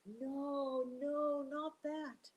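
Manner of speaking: high, loud sham plea; Turtle screaming. Acted. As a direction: Turtle pretending terror of the river. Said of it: "Turtle began to scream".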